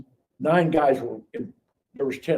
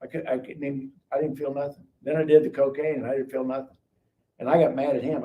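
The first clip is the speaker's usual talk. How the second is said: ordinary talk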